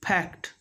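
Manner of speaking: FACT said as "pact"; both lips shut, then a puff: "pact" for FACT; P in place of F in FACT; F made a P. Shown as a demonstration. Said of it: "But this is wrong".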